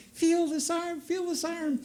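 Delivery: high, breathless mock panic; excited nurse. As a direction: squeal it like a frantic young nurse. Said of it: "grabbing my arm and saying".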